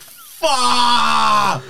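high, loud drawn-out swear; panicked shout. Retold or imitated. Hearing police are circling the buses, retold panicked shout. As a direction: yell it out in mock panic.

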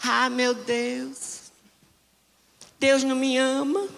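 whiny self-pitying believer, hammed-up; mock sad whine; mock whining. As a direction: whimper like a sulking church member. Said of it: "começa a chorar".

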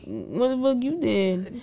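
hushed, slow disbelief; incredulous hushed question. Retold or imitated. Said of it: "I'm like".

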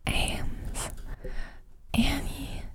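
breathy whispered names, drawn out; sexy voice. Shown as a demonstration. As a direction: read names seductively in a whisper. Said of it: "Can I say them in a really sexy voice?".

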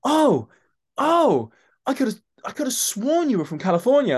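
put-on high, loud and amazed, in a Californian accent; imitating the Californian AD. AD realises the actor is from London, in an amazed Californian accent. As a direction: gasp it out in a Californian accent, stunned. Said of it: "I think she was from California".

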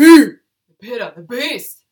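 loud, slow monster-truck hype; booming announcer. Pretend. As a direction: bellow it like a ring announcer. Announcing the Pitta type.

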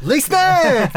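high, loud mocking yell of Lee snack!; mocking shout. Real, not acted. Teasing a friend's silly wine guess, high mocking shout. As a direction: shout the guess back in delighted ridicule. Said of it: "mocking the guess".

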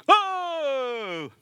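preacher imitating the army's shout; war cry. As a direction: bark it like a soldiers' war cry. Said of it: "vydali váleč-, válečný pokřik".

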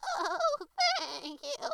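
very high, loud bleating goat lady. A narrator's reading. The goat lady thanks the rabbit, bleating loud and high. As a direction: bleat it out like an excited nanny goat. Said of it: "bleated Auntie Letty".

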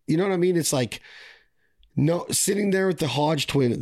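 ordinary talk

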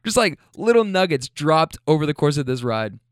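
normal talking voice

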